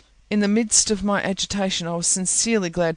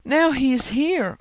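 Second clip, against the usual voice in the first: higher, slow urgent cry; alarmed exclamation; 8 semitones higher, 5 dB louder than usual. A narrator's reading. Nelly warning Heathcliff the master is coming, alarmed. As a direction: sound the alarm that he has come. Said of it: "I exclaimed".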